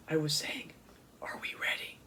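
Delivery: soft unvoiced whisper. A narrator's reading. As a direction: breathe the words. Sophie now whispering.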